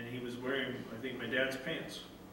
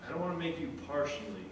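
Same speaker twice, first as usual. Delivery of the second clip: tender father; 4 semitones lower, 9 dB quieter than usual. Retold or imitated. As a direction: comfort a lost child gently. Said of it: "He says".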